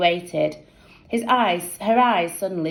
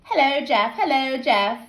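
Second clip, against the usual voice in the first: teasing; 7 semitones higher than usual. A narrator's reading. Teasing Jeff.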